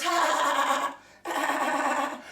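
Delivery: mock celebrity laugh, drawn out; fake laugh. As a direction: ham up a forced, phony laugh. Fake laugh in a quoted impression.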